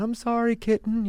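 hammed-up contrite husband at the door; mock sheepish Churchill. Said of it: "knocking on her door going".

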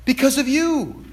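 high, drawn-out accusation; impassioned rebuke. Acted. Jesus blaming those who block prayer, impassioned rebuke. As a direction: land the blame slowly, pitched high.

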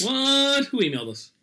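sudden high startled what; surprised. Real, not acted. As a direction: blurt it out in shock. Surprised at news of email.

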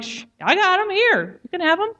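high, hurried voice anxious to appease; eager to please. Retold or imitated. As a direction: offer it up fast to keep him calm. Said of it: "I was like".

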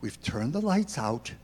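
put-on gentle dad calming his kid; reassuring dad. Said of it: "It's like a dad with a kid".

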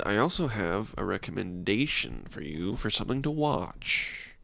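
soft, measured, deliberate delivery; John Malkovich. Real, not acted. Host teasing a recommendation to co-host, oddly soft and deliberate. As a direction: let it trail down softly, weighing each word. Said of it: "You say it like John Malkovich".

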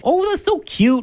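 high-pitched cooing over an animal; high mock gushing; gushing tourist. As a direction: mimic an onlooker melting at something cute. Voicing gushing wildlife onlookers.

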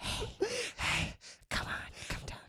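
urgent breathy whisper. Retold or imitated. Coaxing her down from the bunk, whispered. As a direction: plead under your breath. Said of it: "I whispered".

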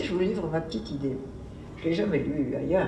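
ordinary talk, nothing marked

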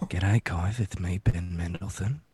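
low, hushed Australian character voice; Ben Mendelsohn. Acted. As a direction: play a soft, low-voiced Aussie actor. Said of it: "does a Ben Mendelsohn impression".

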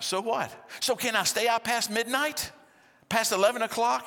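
put-on high, loud pleading teen; whiny teen. Daughter pleading with parent, high.

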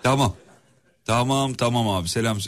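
ordinary delivery

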